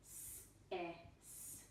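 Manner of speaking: whispered letter sounds s, e, s; sounded out letter by letter. Shown as a demonstration. Teacher sounding out a nonsense syllable, whispered letter sounds. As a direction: sound out each letter of "ses" in a soft whisper. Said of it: "For example".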